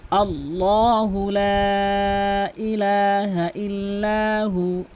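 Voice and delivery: slow, drawn-out melodic recitation; Quran recitation. Shown as a demonstration. Teacher recites the Ayatul Kursi line to students, slow melodic chant. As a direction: chant the verse slowly and evenly.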